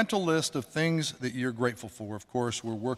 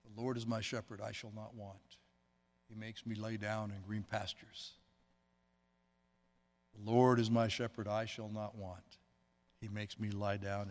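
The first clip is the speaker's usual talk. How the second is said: quiet prayer; 4 semitones lower, 11 dB quieter than usual. Shown as a demonstration. Pastor shows the congregation a silent prayer, murmured much softer. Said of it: "Silently repeat a prayer for inner peace".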